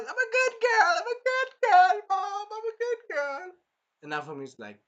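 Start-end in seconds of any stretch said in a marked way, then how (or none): 0.0-3.5 very high, loud mock squeal; hammed-up begging to mom, last one dragged out; squealing fake-innocent girl voice; whiny princess plea. Acts princess pleading with mother.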